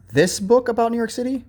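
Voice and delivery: "this book" lifted high to mark the right choice; correct version, pitched high. Shown as a demonstration. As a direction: sing up "this book" as the correct one. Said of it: "look at the picture in".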